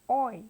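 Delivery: "Oi" as one loud, clear gliding vowel; diphthong demo. Shown as a demonstration. Teacher shows learners the vowel in boy, loud clear "Oi". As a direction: sound out "Oi" as in boy. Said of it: "as in".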